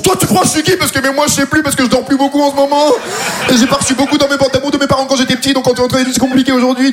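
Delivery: high, half-absurd tough-guy rant; mock confrontation. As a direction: confront him, then drift into confession.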